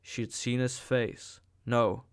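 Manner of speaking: usual speaking voice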